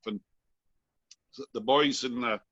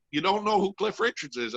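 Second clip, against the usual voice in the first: higher-pitched question in a lightly attempted Scottish accent; 6 semitones higher than usual. Scottish bandsmen quizzing a bandmate about the star, lightly imitated Scottish accent. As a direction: imitate an incredulous Scot, pitched up. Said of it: "attempt a, uh, Scottish accent".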